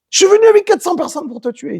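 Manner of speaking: high, fast mock voice; Esav speaking. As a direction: rattle it off in a squeaky Esav voice. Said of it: "il dit Esav". (Esav parle, voix aiguë et rapide)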